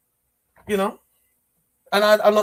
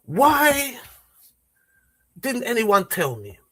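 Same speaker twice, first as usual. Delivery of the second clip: slow, deliberate put-on voice of a female MP, drawn-out pauses; a mock-grave parliamentary speaker, words stretched out; imitating Diane Abbott; slower than usual. Mimicking an MP's slow speech to mock the Prime Minister. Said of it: "I'm gonna do my impression of Diane Abbott".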